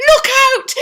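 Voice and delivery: high frantic shout; cried out. Acted. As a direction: yell a sudden warning. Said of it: "he cried".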